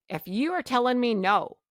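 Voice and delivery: ordinary talk, nothing marked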